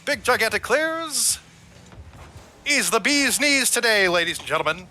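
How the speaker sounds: 1920s radio announcer, high and bright; old-timey voice. Acted. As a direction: call it like a 1930s newsreel. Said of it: "I have to go into old-timey voice".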